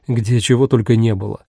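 plain reading voice

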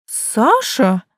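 startled mother's gasp; gasping surprise. A narrator's reading. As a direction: catch your breath in surprise. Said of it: "ахнула мама".

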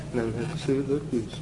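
low, very soft and quick; hushed voice. Shown as a demonstration. Preacher showing the class a tiny delivery, hushed and quick. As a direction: drop it to a small hushed murmur.

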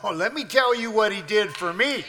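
loud, high, rousing sermon climax; full-force preaching. Acted. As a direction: preach it out to the whole church.

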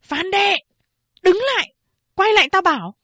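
high, loud shout to stop her; mother yelling. A narrator's reading. Mother shouting at daughter Phán Đệ to come back. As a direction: bark the order across the yard. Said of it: "Mẹ tôi quát to".

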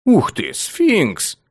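slow, high astonished exclaim; amazed wow. Acted. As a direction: stretch out the wonder. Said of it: "я произнёс это вслух".

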